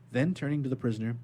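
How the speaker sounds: ordinary delivery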